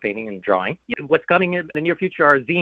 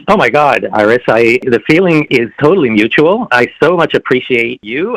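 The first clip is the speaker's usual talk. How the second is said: louder, effusive and heartfelt; gushing thanks; 8 dB louder than usual. Real, not acted. Actor thanking the podcast host Iris, loud and gushing. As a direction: pour out real gratitude.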